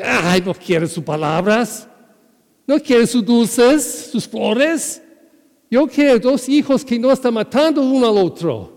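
a worn-out mother who just wants peace; exasperated mother. Acted. Fed-up mother voiced in story.

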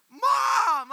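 little brother running to mom; kid yelling for mom. Retold or imitated. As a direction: call out for mom like a tattling kid. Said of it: "He's like".

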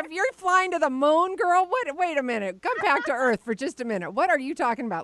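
high-pitched, amused incredulity; laughing into high, incredulous protest; playful disbelief. Real, not acted. Teasing disbelief at friend's claim.

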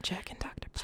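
breathy seductive whisper; whispering sexy voice. Acted. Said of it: "my sexy voice is just whispering".

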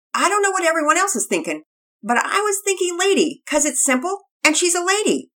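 put-on high, loud child voice; a kid proudly pitching a name; young boy Pearson.

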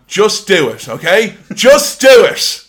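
loud hammed-up ad slogan; mock Nike ad voice. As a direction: sell it like a sneaker commercial.

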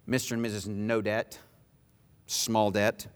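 low, hushed sermon close with long pauses; solemn preaching. Real, not acted. Preacher telling believers Jesus paid their debt, solemn and low. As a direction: bring it down low, weighty and measured.

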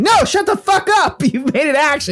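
high-pitched, worked-up rebuke in retelling; exasperated telling-off. Retold or imitated. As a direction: act out an exasperated game master's outburst. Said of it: "And I'm like".

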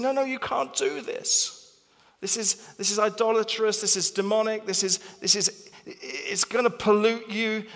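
high, urgent alarmed pleading; alarmed objectors. Retold or imitated. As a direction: voice the weak ones in a panic, pitched high. Weak group voiced in alarm.

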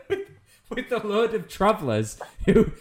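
high, laughing through the words; laughing while talking. Real, not acted. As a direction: crack up while telling the joke. Joking about spreading disease, laughing.

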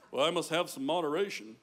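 low, mock-careful pious voice; mock restraint; prim moderate believer. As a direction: say it like a cautious, buttoned-up churchgoer. Mocking a cautious saved churchgoer.

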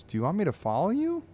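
questioning owner. Acted. Drabble asking his barking dog, quick puzzled question.

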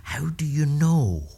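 mum's slow, curious question, lightly voiced; voice of intrigue. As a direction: lean in, lightly playing his curious mum. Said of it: "with this great voice of intrigue".

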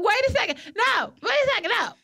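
high-pitched mock protest; frustrated arguing. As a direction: cut in, frustrated at being talked over. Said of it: "I be getting frustrated when argue".

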